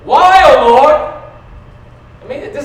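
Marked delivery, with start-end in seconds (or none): 0.1-1.0 loud, higher anguished cry; psalmist's cry. Acted. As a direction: call out to God like the psalmist.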